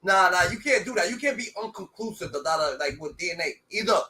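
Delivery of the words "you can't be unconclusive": ordinary delivery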